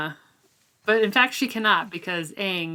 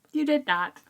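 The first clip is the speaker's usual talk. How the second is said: pitched-up playful accusation; incredulous tease; 8 semitones higher than usual. Retold or imitated. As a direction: call her bluff with a playful high jab. Teasing a character's denial.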